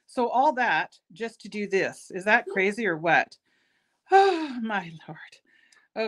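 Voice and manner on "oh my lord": slow breathy whisper, drawn out; whispered exasperation. Real, not acted. Crafter whispering to herself over tangled cord. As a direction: whisper it to yourself, worn out.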